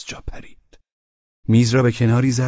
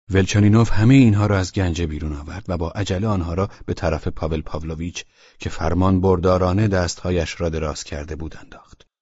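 plain reading voice

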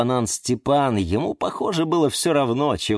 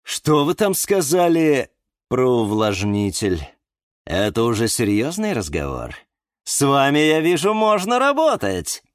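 higher, self-important showman voice; puffed-up flower wizard laying down rules; pompous wizard; 6 semitones higher than usual. A narrator's reading. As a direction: play the grand hyacinth magician. Eduard interested in humidifier.

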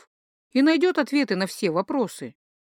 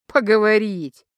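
loud, scoffing single word; snorting scorn; 5 dB louder than usual. A narrator's reading. Husband scoffing at wife's refusal to walk, snorting. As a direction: scoff it out loudly. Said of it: "фыркнул муж".

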